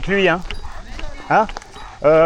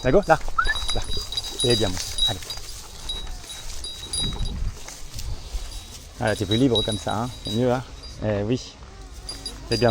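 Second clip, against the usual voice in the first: talking to a dog; 5 semitones lower, 7 dB quieter than usual. Real, not acted. Soothing the dog quietly.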